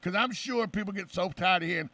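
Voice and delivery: normal talking voice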